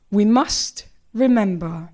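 slow, grave, portentous reading; mock serious. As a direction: deliver it like a profound truth, slowly. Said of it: "my face was very serious".

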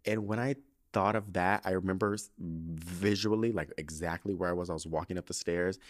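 usual speaking voice

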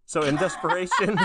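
"So in desperation" cracking into a laugh; can't get the line out for laughing; laughing through the words. Real, not acted. Breaks into laughter mid-recap.

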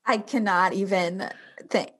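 ordinary delivery

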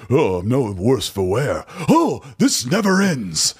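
slow, melodramatic anime voice; overacted anime dub. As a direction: ham it up like an anime hero. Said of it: "super overly dramatic".

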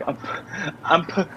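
high, broken-off stammer; can't get the word out; retold sobbed stammer. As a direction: imitate choking on the word through tears. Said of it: "she just kept saying".

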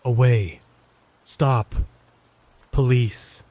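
eerie, isolated words in an altered voice; chilling voice. A narrator's reading. Creepy man speaking without moving his mouth, chilling. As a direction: drop single words like menacing broken echoes. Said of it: "in a voice that sent shivers down my spine".

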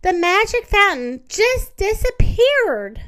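high, loud dismayed cry; upset cry. Acted. Mister Muskrat finds the pebble gone, upset cry. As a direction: cry it out in alarm.

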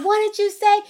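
shocked what. Retold or imitated. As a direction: blurt it out in disbelief. Mother retells her shocked reaction.

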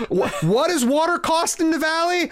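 high, outraged studio exec yelping about the budget; shrill budget panic, voice climbing; panicked producer. Acted. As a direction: play a frantic money man, pitch way up.

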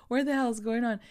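high, loud exasperated outburst; hammed-up frantic bewilderment; mock panic. Acting out panicked confusion.

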